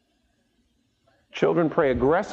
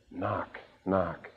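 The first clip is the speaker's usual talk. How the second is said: soft knock knock; 6 semitones lower, 9 dB quieter than usual. Acted. Preacher mimics knocking at the door.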